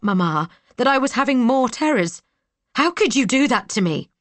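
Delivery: high, loud, tearful outburst of anger; crying out in anger at a brother; rising anger. Acted. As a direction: let the hurt boil over into fury. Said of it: "anger, which crept unbidden into my voice".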